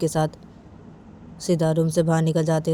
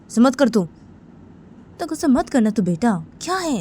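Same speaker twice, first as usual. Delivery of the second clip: angry scolding; 6 semitones higher, 3 dB louder than usual. Acted. Father scolding son in story.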